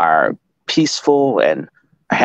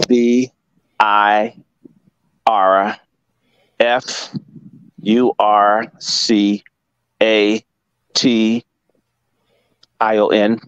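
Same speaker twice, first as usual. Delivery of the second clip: spelling it out; slower than usual. Shown as a demonstration. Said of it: "I'll give you this difficult word".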